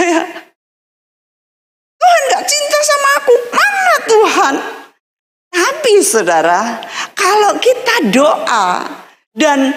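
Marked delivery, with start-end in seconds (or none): 2.0-4.6 put-on tantruming child. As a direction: throw a hammed-up childish fit. Child sulking at God.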